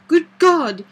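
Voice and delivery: loud, high burst of alarm; shocked cry. A narrator's reading. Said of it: "he cried".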